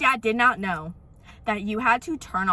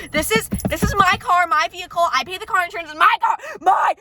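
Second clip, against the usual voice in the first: indignant rising shout; 7 semitones higher, 5 dB louder than usual. Real, not acted. Ranting to viewers about her car, building to a shout. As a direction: pitch climbing higher and louder to a shout.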